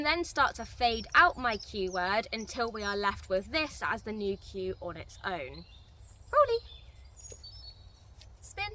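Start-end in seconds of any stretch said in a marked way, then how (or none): 6.3-8.7 calling the dog. Real, not acted. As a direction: sing out the dog's name to get his attention. Trainer calling her dog.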